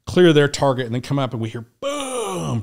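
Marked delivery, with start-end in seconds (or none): 1.8-2.6 high, soft, drawn-out boom; imitated blast. Hearing a breaching charge go off, high drawn-out boom. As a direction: stretch the word into a distant explosion.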